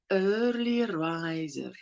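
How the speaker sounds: "early riser" drawn out slowly and softly. Shown as a demonstration. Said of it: "Let's just say it slowly".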